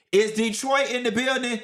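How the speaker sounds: high-pitched playful call to a crowd; playful roll call. Pretend. Host asks the chat if Detroit is here, playful roll call. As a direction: call out like hyping up a room.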